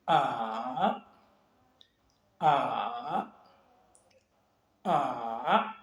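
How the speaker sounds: each "A" dips low, then climbs back up; long falling-then-rising "A"; third tone. Shown as a demonstration. Teacher drills the tone that descends and comes back, "A" dipping. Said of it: "il descend et il remonte".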